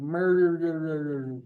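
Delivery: Murderer stretched out very slowly, stumbling on each r; struggling with murderer. Retold or imitated. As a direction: sound out Murderer slowly, tripping over the r's. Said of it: "hardest words that I still struggle with is murderer".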